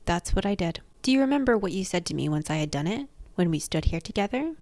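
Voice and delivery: usual speaking voice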